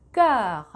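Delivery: "cœur" with rounded lips and an open e-like vowel, stressed; French vowel demo. Shown as a demonstration. Teacher models the vowel in cœur. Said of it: "이렇게 발음을 하셔야 돼요".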